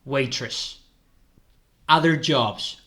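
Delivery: ordinary delivery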